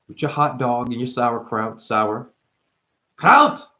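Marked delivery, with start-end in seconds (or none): 3.2-3.7 high, loud one-word outburst; sudden exclamation. Acted. Bursts out one word in bit.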